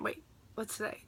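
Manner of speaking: whispering to herself; whispered aside. Real, not acted. Asks herself mid-sentence.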